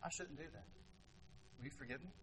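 soft, low, meek apology; a meek, soft-spoken sorry; humble apology. Retold or imitated. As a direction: apologise gently and quietly. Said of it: "I say".

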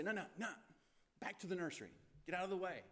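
dismissive shooing. Retold or imitated. Disciples shooing the children away, dismissive mock brush-off. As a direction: shoo them off like a bothered disciple.

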